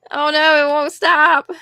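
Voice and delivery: normal talking voice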